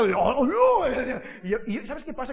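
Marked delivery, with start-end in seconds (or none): 0.1-1.2 high, loud, very drawn-out comic cry; TV catchphrase. Retold or imitated. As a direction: stretch it out like the funny TV man. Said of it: "Yo lo veía en la tele". (grito cómico y alargado, imitando a un personaje)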